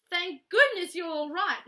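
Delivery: loud, high rush of relief; relieved cry. Acted. Judy bursting in to find Paddington safe, relieved cry. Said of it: "cried Judy".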